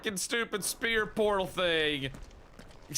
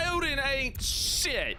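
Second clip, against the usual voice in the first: mocking taunt; 8 semitones higher, slower than usual. Real, not acted. Ranting at boss Odin.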